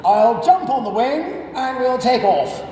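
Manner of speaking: put-on high, hearty old RAF chap; rally the chaps like a cheery ex-RAF officer; jolly RAF type. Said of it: "and goes".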